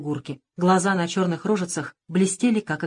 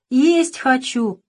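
put-on slow, higher whine of a small hungry child; drawn-out thin little voice; 6 semitones higher, 5 dB louder, much slower than usual. A narrator's reading. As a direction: stretch it out like a tiny child begging. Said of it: "протянул другой тоненький голосок".

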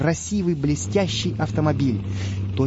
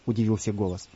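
plain reading voice

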